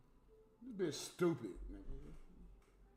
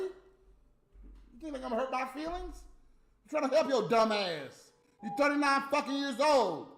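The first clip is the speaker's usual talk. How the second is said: exasperated rant; 5 semitones higher, 4 dB louder than usual. Real, not acted. Ranting at Natalie.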